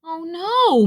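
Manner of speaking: high, drawn-out moan of dismay. A narrator's reading. Rabbit seeing Robot collapse, moaning. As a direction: moan it, swelling with despair. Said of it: "moaned Rabbit".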